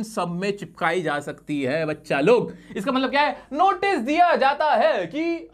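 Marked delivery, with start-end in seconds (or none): 3.5-5.5 slow, high, stretched recital; drawn-out formula. Shown as a demonstration. Teacher translates the stock line for class, drawn-out formula. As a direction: recite it slowly, pitched well up.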